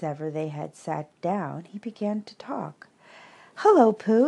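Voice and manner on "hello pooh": higher, louder excited squeak; squeaky Roo. A narrator's reading. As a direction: squeak it like a tiny excited kangaroo. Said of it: "he squeaked".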